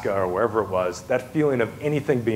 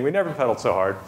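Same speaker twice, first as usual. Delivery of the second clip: ordinary delivery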